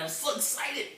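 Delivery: small, high, soft excited squeal; mock excitement. Likening himself to the excited rookie, hushed giddy excitement. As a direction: squeak it softly, mocking your own excitement. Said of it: "Well, this is me".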